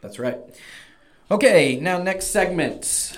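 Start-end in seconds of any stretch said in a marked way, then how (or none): 1.3-3.2 upbeat segment announcer; loud, bright "next segment". Real, not acted.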